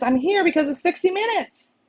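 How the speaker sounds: high-pitched, excited read-out; excited fan message. Retold or imitated. Reads out a fan's message.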